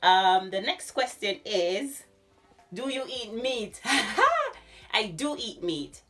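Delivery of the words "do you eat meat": normal talking voice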